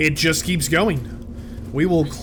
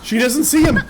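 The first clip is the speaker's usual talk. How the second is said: high, louder alarmed warning; 8 semitones higher, 5 dB louder than usual. Real, not acted. Gamer warning that the troll creeps up, high and alarmed. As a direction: warn her in a rush of fear.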